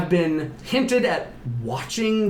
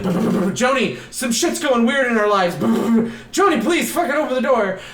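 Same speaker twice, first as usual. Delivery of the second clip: high, raised retold plea with imitated knocking; urgent calling; 7 semitones higher, 3 dB louder than usual. Wife at Joni's door, urgently shouting. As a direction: hammer and call out in mock desperation.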